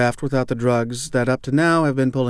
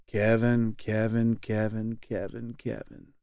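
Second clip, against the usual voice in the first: fading repetition; 7 dB quieter, slower than usual. A narrator's reading. Member repeats name, fading.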